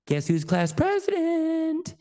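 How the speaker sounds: very high, drawn-out sing-song announcement; proud child bursting to share news; excited kid. Retold or imitated. Child announcing election win to dad, excited. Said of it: "and I'm all excited".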